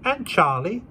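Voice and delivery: put-on high little boy voice; small boy. A narrator's reading. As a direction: answer like a small child. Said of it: "said the boy".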